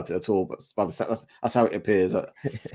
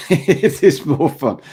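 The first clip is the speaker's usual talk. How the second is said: slow, louder words through a laugh; laughing agreement; 6 semitones higher, 9 dB louder, much slower than usual. Real, not acted. Host agreeing with the guest, laughing slowly. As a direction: chuckle it out slowly, amused.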